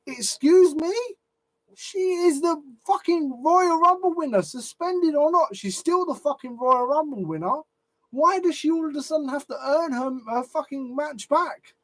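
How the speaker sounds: real fan outrage; indignant outrage. Real, not acted. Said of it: "I'm getting fucking annoyed".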